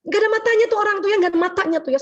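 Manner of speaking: high, fast irritated outburst at the reckless driver; annoyed driver rant. Retold or imitated. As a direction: grumble fast and high, like someone just cut off. Angry driver overtaken, voiced. (sopir kesal disalip di jalan, menggerutu cepat dan tinggi)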